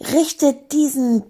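high, indignant tirade; unstoppable rant. Acted. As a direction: rail on insult after insult. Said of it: "war nicht mehr zu bremsen".

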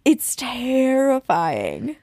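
slow, awed "terrifying"; drawn-out dread. Real, not acted. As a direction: stretch it out in dread. Dwelling on the scare.

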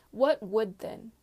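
loud, slow, pointed demand. A narrator's reading. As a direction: demand it forcefully, drawing out the words. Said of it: "she demanded".

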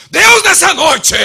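very loud, high, drawn-out prayer cry; mock prayer shout. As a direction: suddenly bellow like an over-the-top preacher. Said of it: "você incorporou alguma coisa?". (grito exagerado de oração, imitando pregador)

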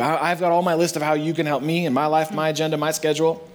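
ordinary talk, nothing marked

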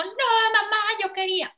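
put-on a high, whiny child protesting to mom; whiny child tantrum. Child whining at mom during a tantrum, high and whiny. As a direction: whine it like a kid mid-tantrum.

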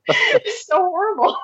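high, slow words through laughter; appalled and cracking up; real laughter. Real, not acted. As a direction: barely get it out for laughing. Cracking up at grim trivia.

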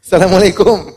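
loud, high hopeful salam; bright loud hello; eager greeting. A narrator's reading. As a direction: try again to be recognized. Last greeting after removing cap.

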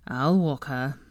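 low-key, tired offer; weary. A narrator's reading. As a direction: offer it with mild weariness. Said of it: "he said wearily".

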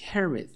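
"Herod" said HEH-red with falling tune; "Herod" in English for learners to repeat; model pronunciation. Shown as a demonstration. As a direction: say "Herod" as a clear model, weak second vowel. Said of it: "Repeat after me".

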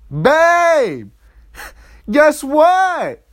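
loud, high-pitched mock girlfriend; excited calling out. Girlfriend calling to her partner with news, excited. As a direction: call out big news in a high voice.

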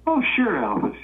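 high-pitched mock agreement; playing along. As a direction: humour a suspected prank caller. Dad humours supposed impostor, winking.

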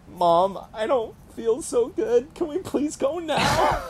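put-on high pleading little-kid voice, a bit slow; whiny sick kid. First grader begging his mom to leave, high and whiny. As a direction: beg like a kid faking a tummy ache. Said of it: "and I was like".

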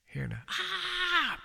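high falsetto scream squeak; a quick hammed-up shriek; mock scream. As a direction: imitate a scream from down the street. Mimicking a distant scream.

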